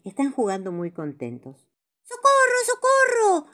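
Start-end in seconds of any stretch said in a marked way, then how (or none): 2.0-3.4 much higher, loud cries of help; shouting for help. Acted. Paco running to friends, screaming for help. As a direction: yell for help, running in panic. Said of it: "grita Paco".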